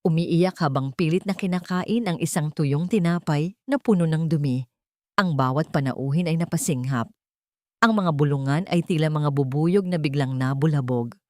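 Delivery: ordinary delivery, nothing marked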